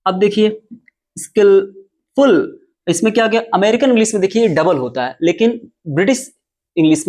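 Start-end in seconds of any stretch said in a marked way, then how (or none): 1.2-2.6 word split by a long pause; dictating by syllables. Shown as a demonstration. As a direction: say it slowly in two separate halves. Teacher dictates word while writing it. (शब्द तोड़कर, धीरे-धीरे बोलते हुए)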